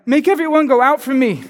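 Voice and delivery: cried out. A narrator's reading. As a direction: call it out, overcome. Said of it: "He cried".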